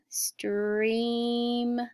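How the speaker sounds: "streaming" stretched into streeeam... ing; "streaming" with long held vowel, then separate ing; sounded out. Shown as a demonstration. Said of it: "sound it with me".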